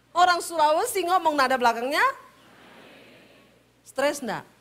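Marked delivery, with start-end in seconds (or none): none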